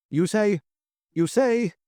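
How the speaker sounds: high, halting stammer; quaking with surprise, words repeated. A narrator's reading. Hotel keeper astonished by the duke, stammering. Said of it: "he stammered".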